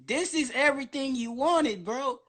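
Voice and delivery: ordinary delivery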